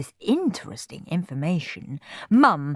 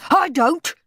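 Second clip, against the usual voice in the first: a hint of a higher shout; shouting boy; 11 semitones higher, 4 dB louder than usual. A narrator's reading. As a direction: call it out. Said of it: "shouted Rude Ralph".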